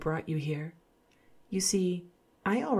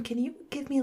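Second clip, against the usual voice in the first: ordinary talk, nothing marked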